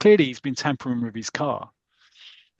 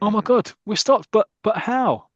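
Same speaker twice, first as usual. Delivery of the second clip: high, louder, breathless astonishment; stunned passenger, much higher and louder; amazed relief; 9 semitones higher, 4 dB louder than usual. Acted. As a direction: gasp it out in disbelief. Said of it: "very dramatic".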